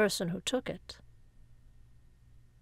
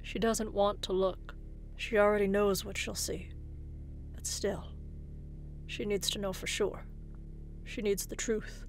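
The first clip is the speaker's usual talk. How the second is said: hushed, fearful narration; 6 dB quieter than usual. A narrator's reading. Aftermath, she is afraid to speak.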